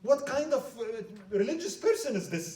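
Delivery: high, loud and outraged; indignant advisor. Acted. Advisor denounces the Patriarch.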